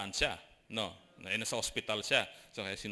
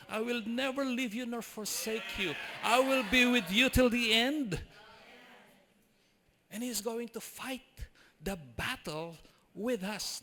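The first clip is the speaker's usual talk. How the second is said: slow, higher, rising to loud proclamation; fervent preached promise; preaching God's promise; 9 semitones higher, 4 dB louder, slower than usual. Acted. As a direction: proclaim it as God's own words.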